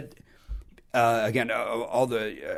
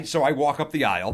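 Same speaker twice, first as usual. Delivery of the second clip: normal talking voice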